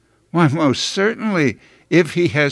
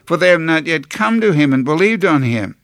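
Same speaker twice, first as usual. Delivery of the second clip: ordinary talk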